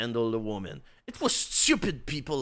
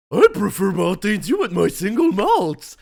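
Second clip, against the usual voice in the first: loud, higher quibble; quibbling; 7 semitones higher, 12 dB louder than usual. Acted. Bruce quibbling with Stevens, loud fussy quibble. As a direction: object fussily. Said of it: "quibbled Bruce".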